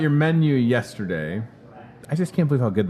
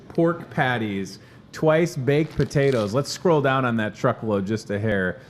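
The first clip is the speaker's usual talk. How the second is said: usual speaking voice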